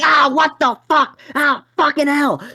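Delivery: high, loud, slow yell of pain; pained scream. Retold or imitated. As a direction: cry out as the dog bites. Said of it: "I'm screaming".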